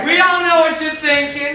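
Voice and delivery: loud, leering jibe; teasing shout. Acted. Wedding guest teasing the groom, a loud leering shout. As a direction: shout it so the whole church hears.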